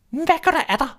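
high, bossy hen voice; a fussy hen nagging; hen's voice. A narrator's reading. Hen nagging the restless duckling, high and bossy. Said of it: "spurgte hun".

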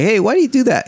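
imitating curious kid. Daughter innocently questioning her mom, imitated curiosity. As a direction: lightly voice a puzzled kid. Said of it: "She's just being like".

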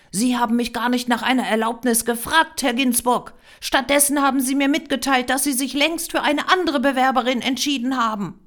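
loud, high indignant retort; outraged, forceful correction; angry accusation. A narrator's reading. Applicant whirls round, accuses photographer.

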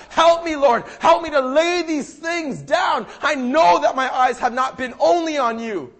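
loud, high pleading prayer; crying out to God. Acted. Preacher voicing a struggler's prayer to God, crying out. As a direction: call out for help, stretching the words. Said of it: "We just gotta cry out to God".

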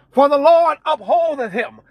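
usual speaking voice

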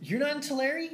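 high disbelieving question; shocked question; surprised. Real, not acted. Surprised at friend's hometown.

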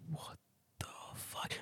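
slow, drawn-out whisper; stunned whisper. Retold or imitated. Customer facing fast French, stunned whisper. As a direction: whisper it to yourself, baffled.

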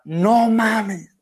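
high, sharp shout of disbelief from the other room; retold dad's yell. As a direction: yell it like a dad stunned by the TV. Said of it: "escucho a mi papá gritar".

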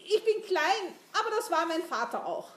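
high, loud self-defence; flustered insistence. A narrator's reading. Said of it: "log Jan mit rotem Kopf".

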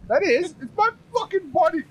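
high squeaky bunny voice. Acted. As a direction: pitch way up for a bunny. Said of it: "That's not the right voice".

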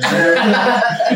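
high, loud burst of laughter; real laugh. Real, not acted. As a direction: laugh out loud at a friend's joke. Joking among friends, laughter.

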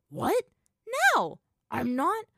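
very high, loud disbelief; incredulous protest. A narrator's reading. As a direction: blurt out a shocked objection. Brother objects to the punishment.

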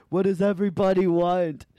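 high, loud imitation; mocking tonsil-girl voice; loudmouth twin. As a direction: call round the car as the loudmouth twin. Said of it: "they're all loudmouth".

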